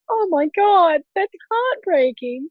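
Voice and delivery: hammed-up teary pity for a brother; mock gushing sympathy. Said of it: "and I'd just be like".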